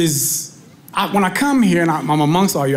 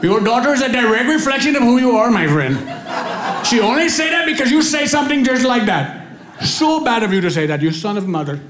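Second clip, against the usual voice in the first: upset Indian man, higher and singsong; Indian accent; 5 semitones higher than usual. Retold or imitated. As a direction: scold in a fussy Indian accent. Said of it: "heard an Indian person cuss".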